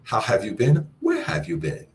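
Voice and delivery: where swooping up high; excited greeting. Shown as a demonstration. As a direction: sing out to a long-lost friend. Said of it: "connotation or voice inflection".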